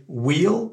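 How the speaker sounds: will said as wheel with a long ee; wheel, long ee vowel, slow; will prononcé comme wheel; French-I will. Shown as a demonstration. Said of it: "avec le I français".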